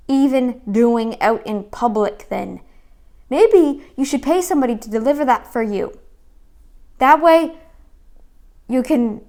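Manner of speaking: loud, scolding outburst at the video; really fed up, raise the voice and scold; angry rant. Real, not acted. Viewer scolding a vlogger for her words, loud and angry. Real rant at clip's wording.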